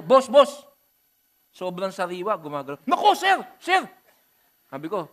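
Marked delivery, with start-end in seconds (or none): 0.0-0.7 high, loud call across the room; calling the waiter. Retold or imitated. Calling waiter about the worm. 2.8-3.9 much higher, loud cry for the waiter; alarmed call. Retold or imitated.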